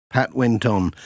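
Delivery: ordinary delivery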